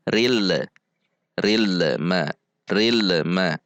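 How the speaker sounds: "ril ma" with a short vowel after l; bounced l. Shown as a demonstration. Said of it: "dipantul seperti". ("ril ma" dengan lam dipantul)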